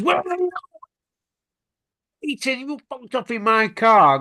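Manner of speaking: high-pitched mock yell down the phone; retold phone screaming. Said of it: "he was screaming out for him".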